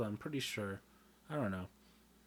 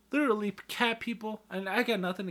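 sudden outburst; 10 semitones higher, 7 dB louder than usual. Real, not acted.

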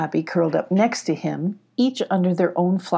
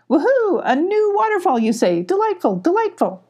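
high hooting owl, thrilled; delighted owl; 11 semitones higher than usual. A narrator's reading. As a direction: sing out each word high like a happy owl. Mama Owl delighted by waterfall.

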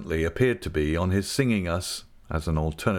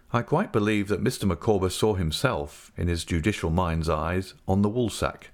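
plain reading voice

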